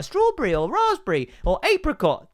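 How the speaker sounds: high-pitched imitation of a little child; adorable small daughter. Daughter saying her yogurt flavour, high child voice. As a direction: lift it up high like a tot listing flavours. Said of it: "it's adorable. She's like".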